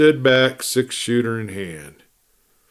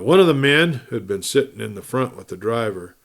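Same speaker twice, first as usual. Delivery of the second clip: ordinary delivery, nothing marked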